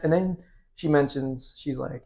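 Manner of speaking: ordinary delivery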